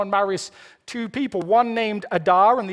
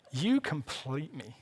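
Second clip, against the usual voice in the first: soft, slow, low romantic movie line; quietly drawn-out film confession; quoted film line; 4 semitones lower, 9 dB quieter, slower than usual. Retold or imitated. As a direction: deliver it slowly and softly, like a movie love declaration. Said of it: "Jerry Maguire".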